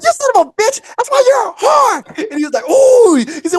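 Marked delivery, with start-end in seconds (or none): 0.0-2.0 1 high furious yell. Retold or imitated. Girl yelling after falling. 2.7-3.2 1 high pained ooh. Retold or imitated. Tim reacting in pain.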